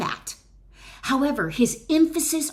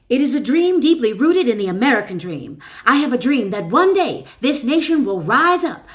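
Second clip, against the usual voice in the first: booming, higher civil-rights oratory; Martin Luther King oratory; 7 semitones higher, 7 dB louder than usual. Retold or imitated. Quoting King's dream speech to the listener, loud soaring oratory. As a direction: proclaim it from the Memorial steps, loud and high. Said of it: "declared a dream, a vision, on the steps".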